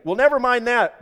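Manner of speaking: loud, high brush-off; calling back. A narrator's reading. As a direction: shout back insistently from below. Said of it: "the voice called back".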